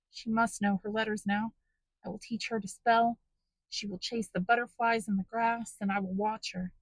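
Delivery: lightly voiced, hopeful sick mother; dreamy happy murmur. A narrator's reading. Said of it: "continued to talk to herself".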